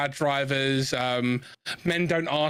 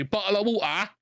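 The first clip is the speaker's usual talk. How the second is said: mock Cockney, slightly higher; 5 semitones higher than usual. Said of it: "Cockneys drop certain consonants and vowels and say".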